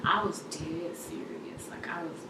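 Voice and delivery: very soft, heavy with hurt; hushed, pained. Real, not acted. Recalling how tired and hurting she was, hushed and pained. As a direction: confide it barely above a whisper. Recalls her lowest moment of pain.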